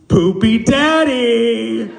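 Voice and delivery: put-on loud, high, drawn-out kid's jeer; four-year-old taunt. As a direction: stretch it out like a gleeful little boy. Four-year-old roasting his caregiver.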